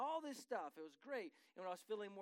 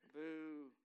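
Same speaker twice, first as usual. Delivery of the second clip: mock boo; 6 semitones lower than usual. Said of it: "mock booing wife's decision".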